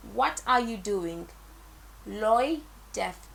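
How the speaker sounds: normal talking voice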